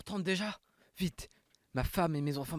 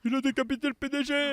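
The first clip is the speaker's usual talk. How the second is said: high panicked scream; horrified shriek; 12 semitones higher, 6 dB louder than usual. Acted. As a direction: scream in horror. Beheading horror.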